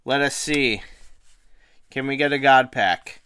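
normal talking voice

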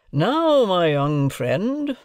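high, drawn-out courtroom address; cross-examining guardian. A narrator's reading. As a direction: address them like a witness in the box. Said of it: "as if I were a witness in the box".

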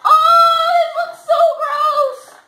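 high, loud, slow disgust; disgusted shout. Real, not acted. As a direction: cry out in disgust. Disgusted at candy.

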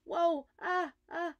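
loud, high panicked cries; germ's cry. A narrator's reading. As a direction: wail as you spin down the drain. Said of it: "cried the germ".

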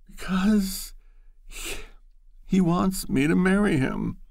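halting tearful admission. Acted.

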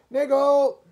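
high, full-voiced shout; loud porter's call. A narrator's reading. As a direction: bellow the name down a platform. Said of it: "He was shouting".

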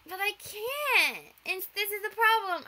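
drawn-out pleading excuse; slow helpless whine. Retold or imitated. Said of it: "I'm just like".

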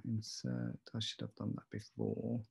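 usual speaking voice

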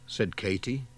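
ordinary delivery

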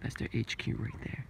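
soft whisper; soft, secret, spying on the ducks; mock conspiratorial whisper. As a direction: whisper like a spy. Spying on duck HQ.

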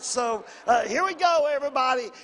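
rally the room, lifting the voice; rallying call. Acted.

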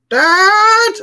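put-on high, loud child's shout of Dad; child calling. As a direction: kid yelling for his father. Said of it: "was like".

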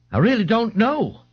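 high-voiced, mildly glum old man. A narrator's reading. An old man answers in the precinct, mildly glum. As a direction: answer with a touch of gloom. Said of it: "the old man said glumly".

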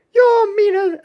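very high, loud squeak; high character voice. Acted. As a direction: blurt it in a squeaky cartoon voice. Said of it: "wrong voice".

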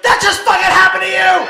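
high, loud taunting shout; retold friend's yell. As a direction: holler it at him in mock disbelief. Friend yelling at the beaten man.

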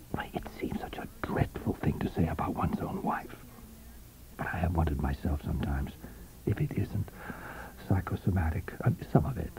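low, soft, confiding murmur; hushed confiding husband. A narrator's reading. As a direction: confess something shameful in a hush. William's voice in the story.

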